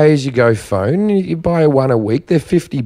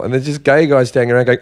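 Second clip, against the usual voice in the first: ordinary delivery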